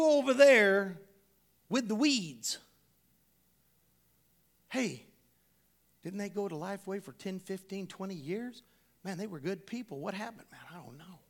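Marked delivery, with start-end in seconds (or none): 4.7-11.2 softer, murmured aside between churchgoers; a quiet, pitying whisper fading out; hushed gossip. Pretend. Two members murmuring about a family who left, hushed.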